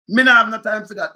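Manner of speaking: loud emphatic dismissal. Real, not acted. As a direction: slam the refusal down. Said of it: "Me not do that".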